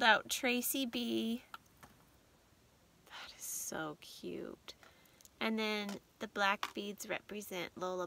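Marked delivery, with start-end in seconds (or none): 3.1-4.7 soft unvoiced whisper. Real, not acted. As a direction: breathe it out, moved. Emotional whisper over gift.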